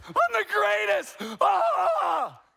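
the dog yelling with delight at the praise; happy dog voice. Acted. Fido hearing the cooing and thinking he is great, high gleeful yell. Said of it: "What's Fido thinking?".

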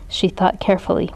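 ordinary delivery

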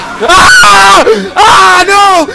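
high screaming and swearing; jump-scare scream. Real, not acted. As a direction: scream in real fright. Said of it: "That was a manly scream".

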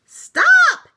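very high, very loud shout; yelled. A narrator's reading. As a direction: yell to halt all the traffic. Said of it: "yelled Blue".

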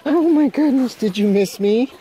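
high, loud gushing at the dogs. Real, not acted. Excited reunion with dogs.

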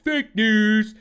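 loud high mocking jab; mocking quote. Mocking a brainwashed guy, loud high jab. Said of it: "He's like".

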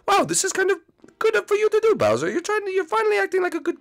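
very high, fast little creature voice; chirpy high toy voice teasing the villain; high squeaky character. Pretend. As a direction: squeak it out like a tiny cartoon creature.

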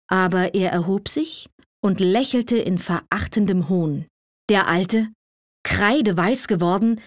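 plain reading voice

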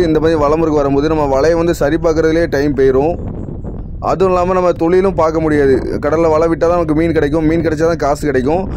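ordinary talk, nothing marked

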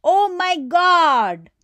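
slow, loud, high "Oh my God"; shouting. Shown as a demonstration. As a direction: stretch it out in a loud shocked cry. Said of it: "You see a girl shouting".

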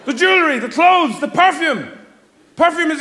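mock furious shouting; angry rant. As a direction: hammer it home in fury. Building rant.

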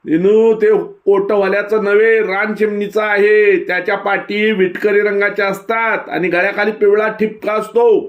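put-on high, eager know-it-all kid voice; young boy Hamza. A narrator's reading. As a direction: explain like a proud village boy. Voices Hamza explaining the nest.

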